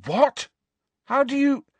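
high, loud disbelief; startled high-pitched outburst; shocked. A narrator's reading. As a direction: blurt questions in shock. Friends react to alarming news.

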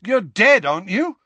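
loud, high retort; exasperated. A narrator's reading. Harry confronts the unhelpful ghost, exasperated. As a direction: throw it at him, exasperated.

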